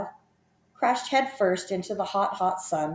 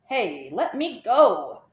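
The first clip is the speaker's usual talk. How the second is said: crocodile yelling; 3 semitones higher, much slower than usual. A narrator's reading. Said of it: "yelled the enormous crocodile".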